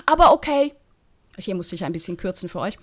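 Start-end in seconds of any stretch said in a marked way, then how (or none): 0.0-0.7 sarcastic. Acted. Bertram gives in sarcastically.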